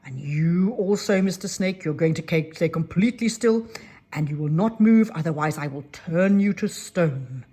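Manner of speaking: the fairy orders the last snake still, loud and stern; stern loud warning. Acted. Fairy threatens second snake.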